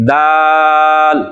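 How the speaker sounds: دال drawn out very slowly with a thin sound; دال with a light thin d, held long; correct dal. Shown as a demonstration. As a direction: model the right dal, thin and stretched. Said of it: "ایسے نہیں، بلکہ".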